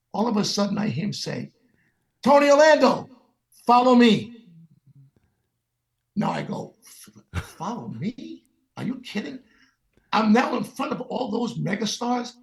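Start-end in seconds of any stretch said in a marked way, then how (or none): 2.2-4.5 high, loud summons across a room; commanding call. Retold or imitated. Sinatra summons a young singer before all the stars, commanding call. 7.3-9.4 stammered, softer incredulous question; stunned disbelief. Retold or imitated. Young singer answers Sinatra's summons, stammering in disbelief.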